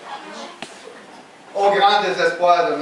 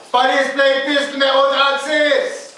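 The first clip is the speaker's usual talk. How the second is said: re-enacted shout, pitched up and stretched; retold childhood cry; 5 semitones higher than usual. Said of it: "Puis là, j'ai crié".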